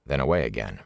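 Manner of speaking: ordinary delivery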